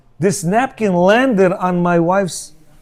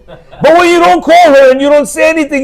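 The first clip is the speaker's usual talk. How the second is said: excited; 9 semitones higher, 6 dB louder than usual. Real, not acted. Loud, high emphasis.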